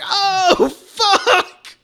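high, loud laughing oh fuck; shocked. Retold or imitated. Recalling his reaction to the shooting, high shocked laugh.

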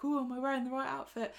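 high, quick nervous worry, imitated; nervous fretting. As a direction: voice someone anxiously fussing, lightly hammed. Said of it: "she's all nervous".

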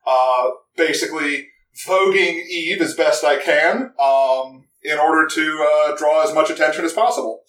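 ordinary talk

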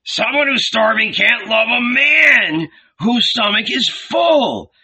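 put-on angry old man shouting. A narrator's reading. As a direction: yell him down, then storm off. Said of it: "growing angry".

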